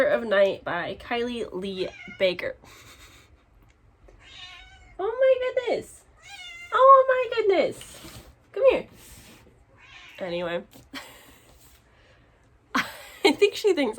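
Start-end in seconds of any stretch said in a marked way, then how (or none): 5.0-8.9 very high-pitched pet talk; cooing to pet. Real, not acted. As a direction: exclaim to your pet way up high. Talking to her pet.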